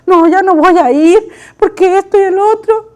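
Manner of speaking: lightly hammed-up tearful refusal; mock weepy complaint. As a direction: play at self-pity without real tears. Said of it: "si yo me pongo a llorar".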